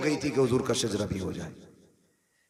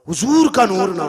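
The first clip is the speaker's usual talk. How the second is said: high, loud exalted cry; 5 semitones higher, 9 dB louder than usual. Acted. Preacher exalting the Prophet's light to the crowd, loud and high.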